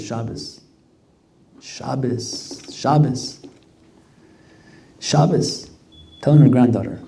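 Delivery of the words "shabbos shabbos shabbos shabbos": soft murmur, fading up to normal; whispering. Retold or imitated. She whispers in the girl's ear. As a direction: grandmother murmuring into her granddaughter's ear, softly. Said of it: "she's whispering into her ear".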